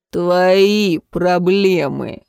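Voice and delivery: slow, curt brush-off through the teeth; through gritted teeth. A narrator's reading. Man brushing off the limping girl, through gritted teeth. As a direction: grind it out, drawn out. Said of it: "процедил он сквозь зубы".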